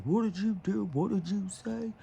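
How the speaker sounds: imitating an affronted cop; indignant cop. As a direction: retell it as an incredulous, high-pitched demand. Acting an incensed cop confronting a kid.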